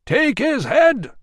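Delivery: high, loud crowd yell. A narrator's reading. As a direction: yell it together. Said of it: "yelled the crowd".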